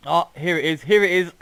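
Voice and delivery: thrilled, high and loud; excited. Real, not acted. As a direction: found it at last, delighted. Finally spots the funny bridge.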